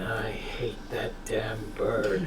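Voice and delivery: hushed, slow muttered aside; whispered grumble. Acted. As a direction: whisper it bitterly. Grumbling aside about the parrot.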